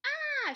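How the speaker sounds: high, loud startled shriek. A narrator's reading. Rabbit startled by a speaking nut, shriek. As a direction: shriek "ah" in alarm. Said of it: "shrieked Littlest Rabbit".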